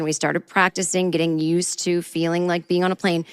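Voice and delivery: normal talking voice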